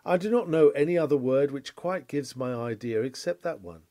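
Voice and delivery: usual speaking voice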